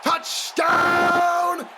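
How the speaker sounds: shouted high touchdown call. Real, not acted. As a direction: scream it as he breaks away. Interception return call.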